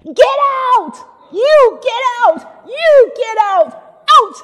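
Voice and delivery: full-voiced high shout, drawn out; screaming. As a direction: imitate a woman screaming at everyone in labor pain. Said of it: "She started screaming".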